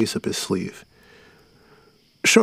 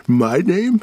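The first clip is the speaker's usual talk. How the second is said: higher, louder mocking shriek; 8 semitones higher, 5 dB louder than usual. A narrator's reading. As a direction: shriek the question back at him. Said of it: "he shrieked".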